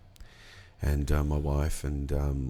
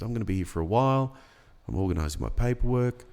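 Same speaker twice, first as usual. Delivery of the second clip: mildly pressing invitation, higher pitched; retold insisting; 6 semitones higher than usual. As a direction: quote a host gently insisting, lifting the pitch on the plea. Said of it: "He was kind of insisting".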